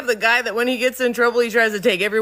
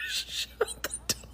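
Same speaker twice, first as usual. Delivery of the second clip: whispered aside; 17 dB quieter than usual. Retold or imitated. Boss whispering the repeat so nobody outside hears. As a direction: whisper it, conspiratorial.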